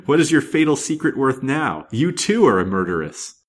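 loud, high villainous taunt; triumphant, ringing accusation; fiendish gloating. A narrator's reading. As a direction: crow over your victim with a spoken ha-ha. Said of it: "with a fiendish laugh".

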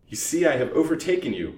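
smooth. A narrator's reading. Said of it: "was her smooth salutation".

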